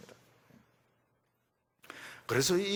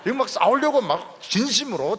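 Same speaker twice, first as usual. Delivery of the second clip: loud, high earnest push on 싸우려고; eager fighting spirit; 8 semitones higher, 9 dB louder than usual. Acted. David eager to fight in earnest, loud. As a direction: press forward eager to fight. Repeated phrase, heated.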